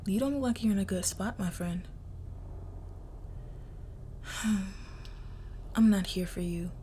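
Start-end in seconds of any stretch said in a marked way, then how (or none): 1.9-5.7 long breathy sigh. Acted. Crime princess weary of the captive, a sigh. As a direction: let out a long, soft sigh. Captor addressing bound captive.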